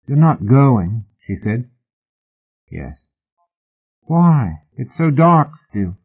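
lightly voiced pleading woman; a hint of a disappointed lover; Hetty's plaintive voice. A narrator's reading.

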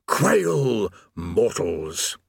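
very slow, drawn-out doom voice; demonic proclamation. Acted. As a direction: boom it like a hellish herald. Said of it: "Various mouths beamed".

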